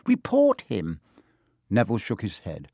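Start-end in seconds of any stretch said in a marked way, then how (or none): 0.1-1.0 very high, insistent plea; urging. A narrator's reading. Girl pushes bullied friend to report it, urgently. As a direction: urge it with pitch lifted right up. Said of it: "Hermione urged Neville".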